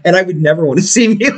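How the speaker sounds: said through a laugh. Real, not acted. Joking to his friend that he'd never watch himself, laughing. Laughing while self-deprecating.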